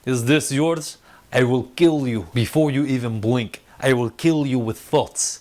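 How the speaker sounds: put-on menacing Eastern European accent, deliberate; Sokovian accent. Wanda figure confronts the soldiers, threatening Sokovian accent. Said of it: "her Sokovia accent's back".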